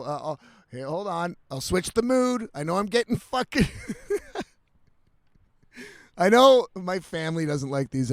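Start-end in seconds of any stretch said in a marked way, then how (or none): 3.7-4.4 quick high giggle; brief embarrassed laugh. Real, not acted. Laughs at own swearing.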